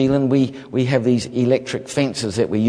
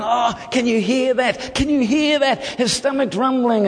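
high-pitched, mock-thrilled crowd voice; excited onlookers; 9 semitones higher than usual. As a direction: imitate amazed onlookers, pitched way up. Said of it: "they were all excited going".

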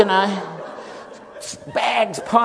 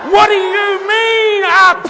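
much higher, loud alarmed yell; panicked shout; 11 dB louder, slower than usual. Retold or imitated. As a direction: cry out in takeoff panic. Panicking passenger on takeoff.

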